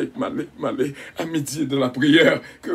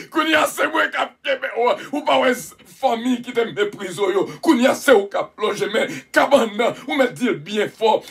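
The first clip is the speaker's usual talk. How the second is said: preaching at full force; 5 semitones higher than usual. Real, not acted. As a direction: preach it out, voice raised high. Preacher raises voice and shouts.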